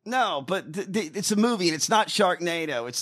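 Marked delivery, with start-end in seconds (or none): none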